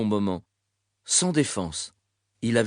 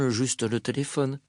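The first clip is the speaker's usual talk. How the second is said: usual speaking voice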